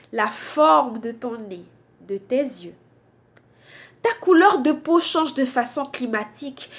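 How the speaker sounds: emphatic slam delivery in short phrases with pauses; spoken-word poem. Acted. Poet addressing those behind racism, emphatic spoken-word recitation. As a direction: recite a protest poem, leaning hard on key words.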